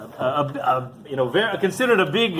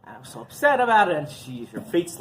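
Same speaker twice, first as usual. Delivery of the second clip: ordinary talk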